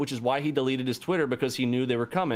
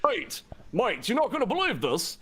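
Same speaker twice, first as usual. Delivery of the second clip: high, mock English accent, calling mate; a terrible English accent, pitched up; bad English accent; 8 semitones higher than usual. Retelling Dante's call to his friend, in a bad English accent. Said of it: "terrible doing an English voice".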